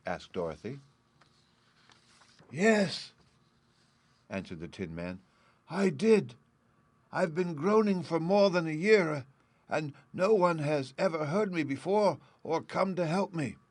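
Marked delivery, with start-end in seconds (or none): 2.5-3.1 a high, sad Tin Man creaks out yes. A narrator's reading. Rusted Tin Man answering Dorothy, high and sad. 5.7-13.6 high, plaintive tin woodsman voice; sad Tin Man. A narrator's reading. Rusted Tin Man telling Dorothy of his groaning, high and sad.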